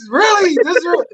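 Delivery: high, loud incredulous question; retold disbelief. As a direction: voice leaps up in mock astonishment. Incredulous at autograph request.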